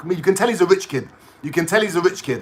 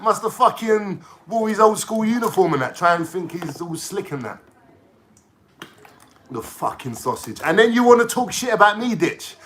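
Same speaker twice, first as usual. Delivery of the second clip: normal talking voice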